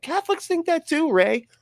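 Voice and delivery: high, strained exasperated cry; exasperated retort. Retold or imitated. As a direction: squeal it out in disbelief. Mocking outburst at Ray Comfort.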